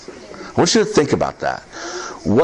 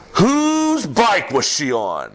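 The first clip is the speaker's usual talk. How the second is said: higher, slow, each word drawn out; slow urgent question; 6 semitones higher, much slower than usual. Retold or imitated. Pastor at the crash asking whose bike it was, slow and urgent. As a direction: ask it slowly, higher, at the accident scene. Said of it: "I said it like that".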